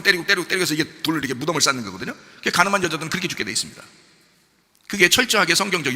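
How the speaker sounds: ordinary talk, nothing marked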